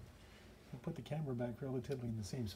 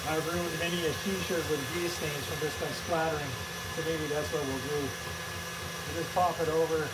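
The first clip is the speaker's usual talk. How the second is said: raised voice over a running mixer; louder, carrying demonstration voice; talking louder over machine; 5 dB louder than usual. Real, not acted. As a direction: project louder to be heard over the motor. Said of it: "I'll try to talk a little bit louder".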